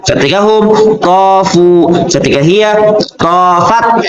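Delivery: ordinary talk, nothing marked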